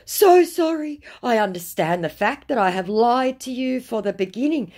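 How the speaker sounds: high, exaggerated imitation of grovelling; mock apologetic. Scammer admitting he lied from the start, mock apologetic. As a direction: open loud and high, over-sorry.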